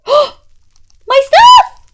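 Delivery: high, loud shriek of dismay; shocked shriek. Real, not acted. Streamer shrieking at her burned chest. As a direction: cry out at the lost stuff. Base destroyed by fire.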